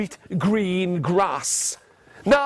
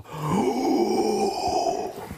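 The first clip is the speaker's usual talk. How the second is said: long theatrical intake of breath; mock frightened gasp; 3 dB quieter than usual. Storyteller reacting to the troll, mock frightened gasp. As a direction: gasp as if scared.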